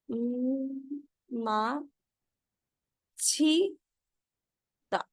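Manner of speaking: slowly spelled out: म- मा- छी- ता, with pauses; syllable by syllable. Shown as a demonstration. Teacher sounds out माछीदा slowly for students. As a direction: break the word into separate beats. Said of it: "ये क्या बनेगी?".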